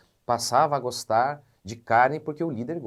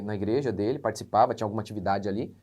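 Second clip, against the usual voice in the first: ordinary delivery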